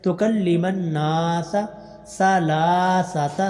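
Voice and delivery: slow drawn-out tajweed chant; slow tarteel recitation; Quran recitation. Shown as a demonstration. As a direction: recite each vowel long and measured. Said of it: "لام کو لمبا کرنا".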